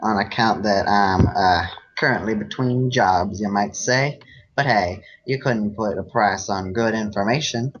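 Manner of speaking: a folksy Southern cowpony sweet-talking a stranger; Braeburn southern accent. Acted. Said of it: "uh, southern accent".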